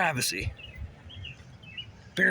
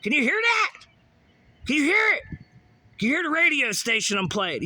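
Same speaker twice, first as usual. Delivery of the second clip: mock taunting voice; 10 semitones higher than usual. Mocking a harasser showing off his radio. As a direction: taunt them with the radio like a prankster.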